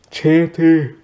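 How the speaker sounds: talking through a real yawn; words swept up in a yawn. Real, not acted. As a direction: let a yawn stretch and swallow the words. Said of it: "Sorry, I had to yawn".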